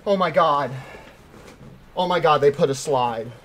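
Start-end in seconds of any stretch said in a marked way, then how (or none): none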